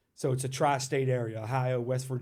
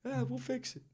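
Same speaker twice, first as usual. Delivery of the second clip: higher, softer shrugging reply; mock brush-off; 11 semitones higher, 5 dB quieter than usual. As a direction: wave it off lightly in a put-on voice. Brushing off the apology, retold.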